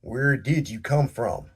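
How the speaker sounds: loud, hostile growl of a demand; growled question. A narrator's reading. Sheriff growling at the stranger, loud and hostile. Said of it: "growled Rockstone".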